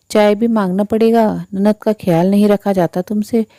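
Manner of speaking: ordinary delivery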